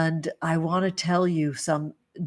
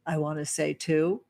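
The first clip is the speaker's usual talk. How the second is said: usual speaking voice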